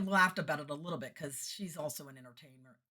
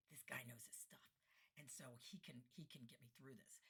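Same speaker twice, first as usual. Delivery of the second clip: low, whispered inner thought; hushed relief; 3 semitones lower, 24 dB quieter than usual. Retold or imitated. Entertainer to herself about the capable tech, hushed. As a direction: confide it under your breath.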